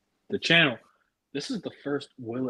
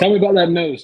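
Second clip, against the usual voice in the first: loud, eager urging; 7 semitones higher, 10 dB louder, faster than usual. Real, not acted. Egging the Judge on about the nose, loud. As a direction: call across to him, loud and eager.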